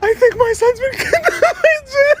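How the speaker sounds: hammed-up wailing plea; mock wailing. Mom wailing to grandma that a djinn took her son. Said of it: "Cut to my mom wailing, screaming".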